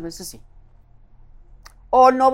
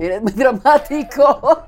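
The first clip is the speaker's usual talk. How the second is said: drawn out slow and laughing, higher and louder; laughing at her dramatic friend; teasing laugh; 6 semitones higher, 4 dB louder, slower than usual. Real, not acted. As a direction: tease him, laughing through it. Teasing him for being dramatic.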